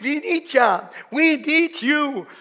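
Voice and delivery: slow, high, tender calling out; loving call. Acted. Parents calling their adopted daughter, slow loving call. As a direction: let the love ring through the name. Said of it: "klang darin diese liebevolle Botschaft mit".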